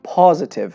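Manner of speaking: پازیتیو with a long open "aa" first vowel; American pronunciation. Shown as a demonstration. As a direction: say پازیتیو the American way, stretched "PAA-zitiv". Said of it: "امریکن انگار با آی کشیده هست".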